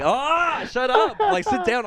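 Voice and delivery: high-pitched exasperated outburst; mock irritated cry, voice leaping up; pissed-off retort. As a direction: act out being fed up with her. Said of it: "I kept getting so pissed every time".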